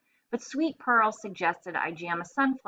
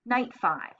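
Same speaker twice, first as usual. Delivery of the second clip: ordinary delivery, nothing marked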